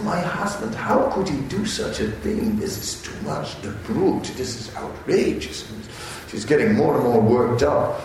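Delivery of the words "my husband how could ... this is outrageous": upset wife. Acted. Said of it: "she's so upset".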